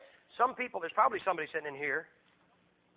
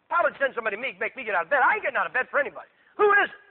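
loud, irritated grumbling rising to a yelled question; hammed-up exasperation, ending high; grumpy Herod; 3 semitones higher, 7 dB louder, faster than usual. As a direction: complain about being dragged from bed. Grumpy Herod refusing to get up.

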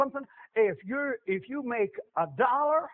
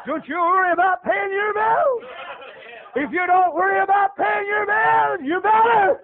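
higher, louder warning to the flock; preaching shout; 7 semitones higher, 4 dB louder than usual. Real, not acted. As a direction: drive the warning home with force.

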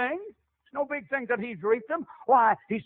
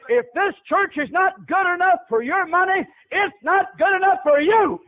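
higher and louder, pressing the point; preaching shout; 5 semitones higher, 5 dB louder than usual. Real, not acted. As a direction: hammer the point to the congregation. Forceful preaching climax.

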